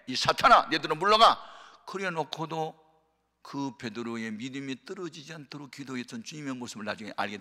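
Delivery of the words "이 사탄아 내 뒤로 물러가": much louder commanding rebuke; Jesus rebuking Peter, loud and stern. Retold or imitated. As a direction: cast him away with authority.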